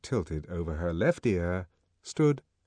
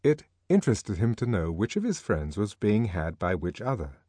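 ordinary delivery, nothing marked

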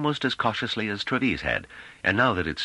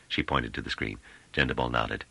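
usual speaking voice

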